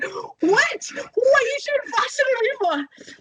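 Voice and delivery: very high, slow, mock-indignant old woman; hammed-up high-pitched protest; upset old woman. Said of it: "getting more and more and more and more upset".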